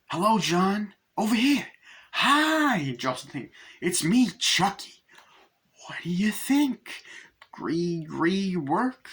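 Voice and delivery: high, taunting doll voice; Chucky's high, mocking greeting to John. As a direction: tease him in a creepy sing-song.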